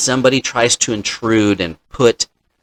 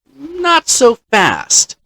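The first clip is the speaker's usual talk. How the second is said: much higher and very slow, teasing the objection; drawn-out not so fast; much slower than usual. Real, not acted. DM cutting off the players' clever plan, drawn out high. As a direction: stretch it out high like a playful warning.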